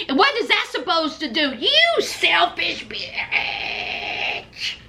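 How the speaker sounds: high, whining mock outrage; whiny Courtney impression. Courtney berating her husband by phone, high whiny mockery. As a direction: play the spoiled wife, pitch way up. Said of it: "And she's all like".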